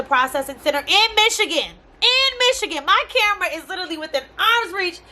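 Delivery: Michigan rising high in disbelief; in MICHIGAN, high and exasperated. Real, not acted. Vlogger venting about a package stuck in Michigan, exasperated disbelief.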